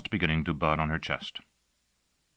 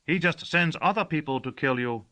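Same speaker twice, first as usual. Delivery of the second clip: servant Gossagor's voice; 12 semitones higher, 4 dB louder than usual. Acted.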